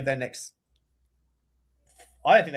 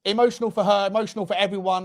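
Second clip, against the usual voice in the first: ordinary delivery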